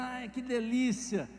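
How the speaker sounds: slow, relishing "Ai, que delícia"; hammed-up pleasure; mock delight. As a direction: savor the words like a tasty bite. Savoring food, mock delight.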